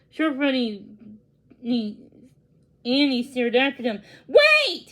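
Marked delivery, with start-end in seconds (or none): 4.3-4.9 high, loud desperate call. A narrator's reading. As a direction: shout after them to stop. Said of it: "he called".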